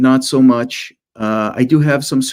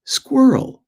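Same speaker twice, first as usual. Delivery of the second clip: excited wife; 8 semitones higher than usual. Retold or imitated. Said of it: "my wife said".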